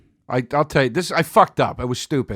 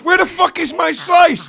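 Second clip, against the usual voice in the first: slow, high-pitched loud demand; retold outrage; 6 dB louder than usual. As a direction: imitate an irate friend yelling, words stretched out. Retold angry friend in story.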